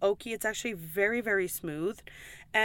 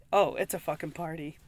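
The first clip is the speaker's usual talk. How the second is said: ordinary talk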